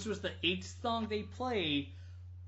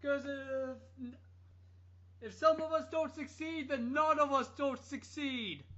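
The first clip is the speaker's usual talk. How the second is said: mock [name]; 8 semitones higher than usual. Parodying the singer's preaching, high mock sermon. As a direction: lay the sanctimony on thick. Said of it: "he just said".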